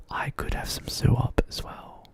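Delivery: breathy whispered aside. Real, not acted. Gaming alone, whispering to himself. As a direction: whisper it to yourself.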